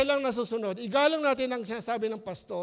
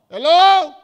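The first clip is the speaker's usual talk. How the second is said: booming call to the congregation, much louder; raised voice; 6 semitones higher, 19 dB louder than usual. Real, not acted. Pastor waking up the congregation with a loud call. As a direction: push the voice out to the whole hall. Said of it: "Nilalakasan ko boses ko".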